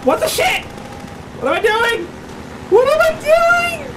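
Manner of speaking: very high, squeaky game panic; frantic falsetto wailing; panicked squealing. Real, not acted. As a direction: squeal helplessly as it all goes wrong. Panicking when lost in game.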